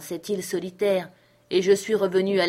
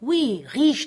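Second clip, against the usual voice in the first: vehement; 5 semitones higher, much slower than usual. A narrator's reading. Ben Gunn insists to doubting Jim he is rich, vehement. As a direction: insist hard so he is believed. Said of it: "il répéta son assertion avec véhémence".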